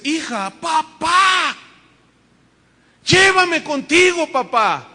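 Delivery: high, loud, slow crying plea; desperate plea. Retold or imitated. Daughter begging her father's apparition, slow wailing plea. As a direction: beg him to take her, stretching the words.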